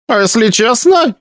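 put-on high, suspicious old woman; granny demanding the truth. A narrator's reading. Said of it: "раздался позади старческий голос".

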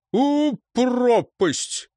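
high, drawn-out curse; beastly irritation. A narrator's reading. Man swinging his stick at pestering dogs, beastly irritation. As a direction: curse the dogs, stretching each word. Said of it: "Хобот зверовато оглядывался".

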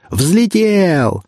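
high, elated drawn-out shout; full-throated yell. A narrator's reading. Ignat cheering as the plane takes off, yelled at the top of his lungs. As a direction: holler it skyward, stretching the vowel. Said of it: "заорал Игнат во всю глотку".

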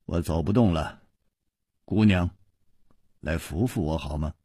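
low, soft, fading plea; exhausted. A narrator's reading. Chu Liuxiang asking girls to support him, exhausted. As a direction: ask feebly, with your strength running out. Exhausted hero asks for help.

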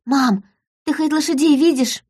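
put-on high-pitched child voice; daughter's voice. A narrator's reading. Daughter asking mother about the horses, high child voice. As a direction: voice a worried little girl.

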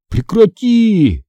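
high, drawn-out protest; grumbling. A narrator's reading. Brother fending off his sister's hug, grumbling. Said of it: "буркнул брат".

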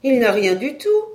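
high, loud amazement; astonished exclamation. A narrator's reading. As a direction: cry it out as if amazed. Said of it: "s'écriait comme étonnée". (exclamation étonnée, haute et forte)

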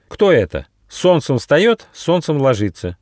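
ordinary delivery, nothing marked

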